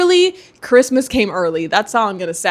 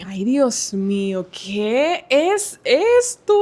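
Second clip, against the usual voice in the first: amazed Spanish exclamation; much slower than usual. Real, not acted. Exclaims at books.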